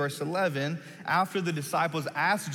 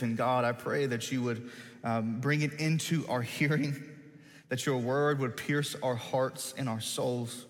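prayer; 4 semitones lower than usual. Acted. Pastor praying over the new series, hushed and reverent.

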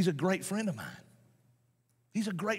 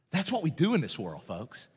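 ordinary talk, nothing marked

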